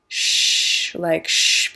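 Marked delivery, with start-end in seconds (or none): 1.3-1.7 a hissed "shh"; shushing. Shown as a demonstration. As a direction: shush someone to be quiet. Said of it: "be quiet".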